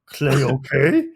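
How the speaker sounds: slow gruff monster question; stilted, gruff monster asking; Cookie Monster voice. Acted. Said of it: "gruff voice stiltedly questioning".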